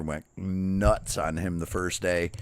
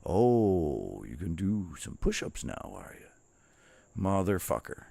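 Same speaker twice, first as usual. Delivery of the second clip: mocking taunt; 5 dB quieter, slower than usual. Mocking drill instructor catching him.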